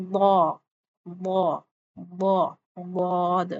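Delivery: very slow واد, the tongue covering the palate; slow heavy zaad. Shown as a demonstration. Teacher demos the full letter slowly. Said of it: "تالو کو کور کر لیا".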